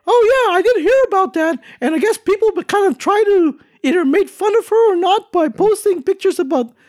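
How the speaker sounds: very high cartoon reporter falsetto; [name]. Theme park correspondent explaining the bang to hosts, high cartoon falsetto. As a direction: report it in a squeaky mouse voice.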